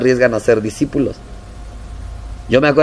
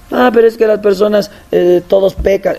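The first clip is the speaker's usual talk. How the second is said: high mock whine; mock the complainer; whiny complaint; 7 semitones higher than usual. Member excuses others. (queja quejumbrosa, aguda)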